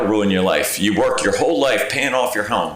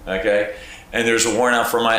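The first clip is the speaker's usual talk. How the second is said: normal talking voice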